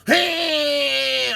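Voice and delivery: high, loud mock yell. Trucker yells as he rolls down the grade. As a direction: yell it out going downhill. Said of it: "and here I go".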